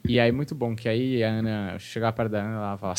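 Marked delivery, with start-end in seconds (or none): none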